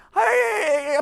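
high crying cry of despair; sudden high sobbing shout; crying wail. Acted. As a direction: wail out like about to burst into tears. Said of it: "规个人计要哭出来".

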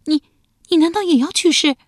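suddenly afraid he means it; frightened question. A narrator's reading. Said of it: "瞪着一双惊恐的眼睛问道".

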